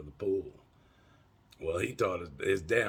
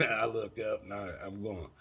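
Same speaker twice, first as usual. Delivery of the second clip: ordinary talk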